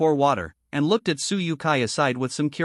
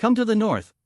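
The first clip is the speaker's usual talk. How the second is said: complaining; 7 semitones higher than usual. A narrator's reading. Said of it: "couldn't help complaining".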